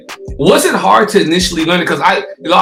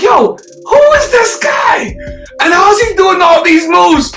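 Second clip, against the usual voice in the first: high, loud hammed-up amazement; amazed reaction, retold; 13 semitones higher, 6 dB louder, slower than usual. Host retelling first seeing the skater's video, amazed. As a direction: cry it out in mock disbelief, drawn out. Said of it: "So I was like".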